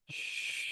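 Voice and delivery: soft drawn-out shushing sound; long shush. Shown as a demonstration. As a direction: shush like soothing a baby. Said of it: "making a shush sound".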